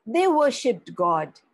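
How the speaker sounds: ordinary delivery, nothing marked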